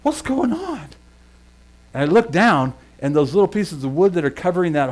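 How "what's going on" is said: sudden high puzzled question; as if the floor just moved under you; startled. Retold or imitated. On the wobbling boards, puzzled and startled. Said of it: "was like".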